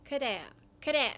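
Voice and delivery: "Claire" said as "ku-de-a"; no L in "Claire", a quick tap instead; "Claire" as falling "ku-de-a"; Japanese pronunciation. Shown as a demonstration. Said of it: "this is how they pronounce it".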